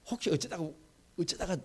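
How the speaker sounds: voice breaking. Shown as a demonstration. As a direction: show the catching sermon voice. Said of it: "목소리가 막 이럴".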